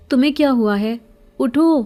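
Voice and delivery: urgent, climbing call of alarm. Acted. Friend collapses, calling him. (گھبرا کر اونچی آواز میں پکارنا)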